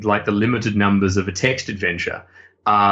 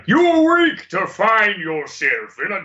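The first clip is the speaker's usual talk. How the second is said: high, drawn-out theatrical British host; Dark Room host, British accent; 8 semitones higher, much slower than usual. Retold or imitated. Game host taunting the player in the dark, theatrical British accent. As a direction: boom out high, slow British menace. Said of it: "me in the dark with a British accent going".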